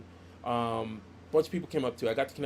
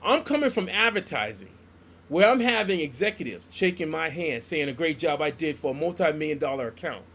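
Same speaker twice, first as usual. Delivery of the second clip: indignant retort; 4 semitones higher, 6 dB louder than usual. Retold or imitated.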